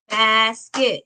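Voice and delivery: "basket" in two separate beats, bas and ket; split into syllables. Shown as a demonstration. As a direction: break "basket" apart as if clapping each syllable. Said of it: "You heard there are two claps".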